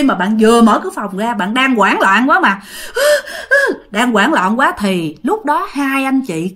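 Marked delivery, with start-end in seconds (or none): 2.7-3.8 panicked gasp. Retold or imitated. Panicked girl bursting out.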